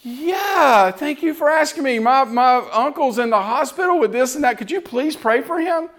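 high, light grateful voice; lightly voiced young woman asking for prayer; imitating grateful girl. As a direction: voice her sweet thanks.

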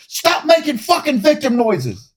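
slow, high mock drill yell; famous instructor quote. Quoting a famous instructor line, slow high mock yell. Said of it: "the famous quote".

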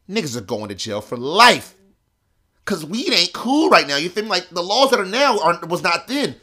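loud, high-pitched alarm; acted panic. Teller to friend facing jail, loud panicked protest. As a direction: raise your voice as the trouble sinks in.

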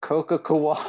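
giggling out the punchline; laughing while saying it; laughing through punchline. Real, not acted.

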